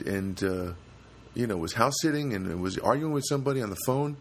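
ordinary delivery

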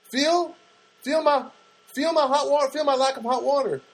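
high, whiny begging; mock pleading. Tenant begging the landlady to check, high and whiny. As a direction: plead high with her to feel it.

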